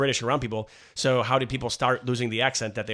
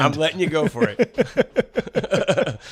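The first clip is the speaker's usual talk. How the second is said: drawn-out tease; 2 semitones higher, 4 dB louder, much slower than usual. Real, not acted. Teasing partner mid-bit.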